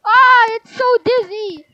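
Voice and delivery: loud, slow dizzy groan of a cry; dizzy outcry. Acted. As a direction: call out woozy after the ride. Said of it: "we're dizzy".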